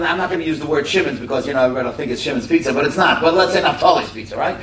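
ordinary talk, nothing marked

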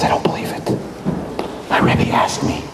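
hushed, whispery disbelief; low whispered realisation; stunned regret. Retold or imitated. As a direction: barely voice it, stricken. Chaim stricken with regret.

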